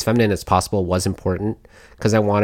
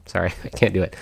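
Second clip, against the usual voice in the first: normal talking voice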